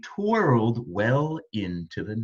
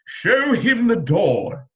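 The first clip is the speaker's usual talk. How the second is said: louder royal command; commanding call; 7 semitones higher, 6 dB louder than usual. A narrator's reading. King ordering the magician out, loudly. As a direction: order him out. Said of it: "called the king".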